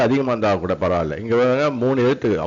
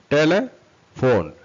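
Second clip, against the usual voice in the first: slow, raised TELE - PHONE with a gap; broken into parts; 4 semitones higher, much slower than usual. Shown as a demonstration. Teacher demonstrates the parts to learners, broken into parts. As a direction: pull telephone apart into slow pieces. Teacher splitting word into syllables.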